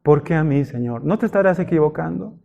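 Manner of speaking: softly whining to God; a small hurt murmur asking why me; hushed, sulky plea; plaintive quiet complaint. Retold or imitated. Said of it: "la típica pregunta que hacemos".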